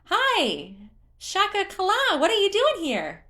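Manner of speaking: very high, loud cheer; cheerful creature. A narrator's reading. Hestu spotting Link, cheering in a very high voice. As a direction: squeal with glee at a friend.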